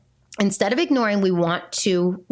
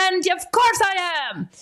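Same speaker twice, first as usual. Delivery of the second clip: slow, much higher mock admission; sarcastic; 11 semitones higher, slower than usual. Mom mocking her own reply to being called out, sarcastic and slow. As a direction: drag it out, dripping with sarcasm.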